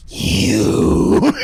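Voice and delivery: low, long drawn-out growl of recognition; menacing accusation. Retold or imitated. Sylvia turns on the Doctor, menacing accusation. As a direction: stretch it out with mock menace.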